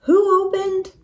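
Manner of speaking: high-pitched startled question. A narrator's reading. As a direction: blurt it out in surprise. Minnie gasps at the opened door.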